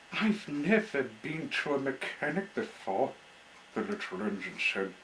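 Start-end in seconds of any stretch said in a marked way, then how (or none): 0.1-3.2 slightly raised, nervous small voice; on the verge of tears; wobbly voice. Acted. The engine tells Peachy, nervous and wobbly. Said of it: "in a wobbly voice".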